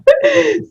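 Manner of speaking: a sharp, very high burst of laughter; real laugh. Real, not acted. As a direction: crack up at the memory. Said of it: "I was just laughing".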